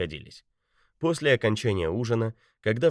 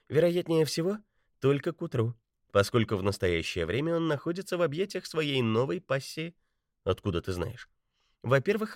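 higher lightly voiced young woman; woman's voice; 6 semitones higher than usual. A narrator's reading. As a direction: speak as the confident agent woman. Betty's voice.

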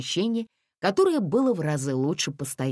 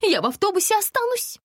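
high-pitched fussy lady voice; much higher, indignant woman's voice; fussy little lady; 5 dB louder, faster than usual. A narrator's reading. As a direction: protest in a high, pouty whine. Дамочка капризно отказывается идти.

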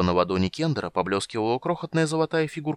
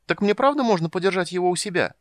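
high, delighted kender voice; high-pitched, thrilled exclamation; excited calling out; 10 semitones higher, 3 dB louder than usual. A narrator's reading. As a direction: gush with wonder, then eagerly beg. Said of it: "крикнул Тас через плечо".

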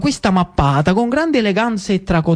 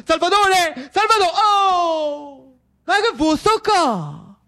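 higher and higher calls, growing alarm; calling anxiously; 8 semitones higher than usual. Acted. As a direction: search the house calling his name. Lucio calls for his brother.

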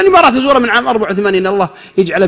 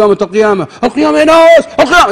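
higher, louder panicked cry; mock yell; 5 semitones higher, 3 dB louder than usual. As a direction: shout the alarm to everyone. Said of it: "يرميها ويصرخ ويقول". (صراخ تمثيلي: قامت القيامة يا ناس)

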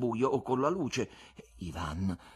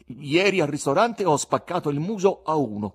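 loud, higher, bold confession; manly confession; 7 semitones higher, 9 dB louder than usual. A narrator's reading. Poet confessing a restaurant brawl to his visitor, manly and loud. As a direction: own up boldly at full voice. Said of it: "confessò virilmente il poeta".

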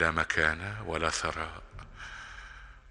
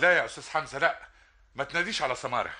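higher, hurried plea; urgent protest; 8 semitones higher, faster than usual. Acted. Suitor begs the father not to call Samara, in a hurried panic. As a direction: stop him quickly.

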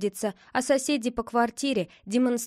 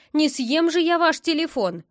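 indignant, higher and louder; flaring up; 6 semitones higher, 5 dB louder than usual. A narrator's reading. Neighbour protesting to the boy over the telephone, indignant. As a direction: protest in a huff. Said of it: "вспыхнула соседка".

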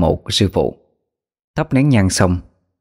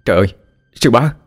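overjoyed cry; 3 semitones higher, faster than usual. Acted. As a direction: blurt it out in sudden joy. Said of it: "mừng quýnh mà thét lên".

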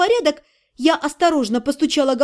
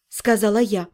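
plain reading voice

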